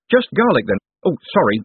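high blurt then sheepish sorry. Acted. Moist blurts word.

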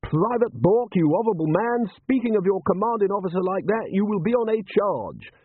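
high, strained parade-ground reprimand; outraged drill sergeant. A narrator's reading. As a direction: scold a soldier for insolence. Butler rebuking a soldier.

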